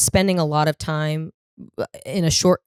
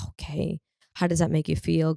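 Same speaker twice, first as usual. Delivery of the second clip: much softer, calm and caring; a patient parent inviting the kid to open up; gentle therapist voice; 5 dB quieter than usual. Retold or imitated. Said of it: "they're like".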